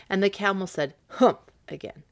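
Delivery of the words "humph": loud humph. Acted. Camel grunts humph.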